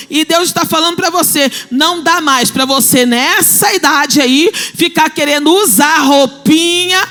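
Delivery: loud, rising preacher's appeal; preaching at full force. Acted. Preacher urging the women to grow up, loud and fervent. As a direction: press the point on the congregation.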